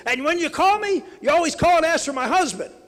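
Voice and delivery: put-on high, loud old lady ranting; angry old woman. Old woman scolds the candidate over phone calls, high loud rant. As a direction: rant it like a fed-up grandma. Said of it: "She goes".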